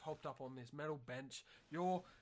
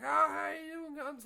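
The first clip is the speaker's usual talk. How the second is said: high, loud hammed-up Aussie bloke greeting; imitating bus driver; 12 semitones higher, 9 dB louder than usual. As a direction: bellow a matey hello like a jolly driver.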